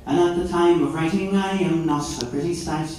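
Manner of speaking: lilting Irish ballad verse, climbing in pitch; rhyming Irish recitation. Acted. Son's text to his mother, lilting Irish verse. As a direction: recite it in a broad singsong brogue.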